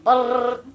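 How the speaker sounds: loud rattling "Brrr" like a machine gun; spray an imaginary rifle with a high, loud "Brrr"; imitated gunfire. Imitating gunfire after target practice.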